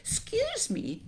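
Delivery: very high polite little voice; courteous young elephant, pitched right up; polite child. A narrator's reading. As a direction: pipe up politely. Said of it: "said the elephant's child most politely".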